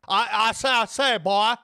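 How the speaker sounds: loud, high, blustering cartoon Southerner; Foghorn Leghorn. Streamer doing a requested character for chat, blustering cartoon impression. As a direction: bluster it out with a mock Southern twang. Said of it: "I thought this accent you were trying to do was Foghorn Leghorn".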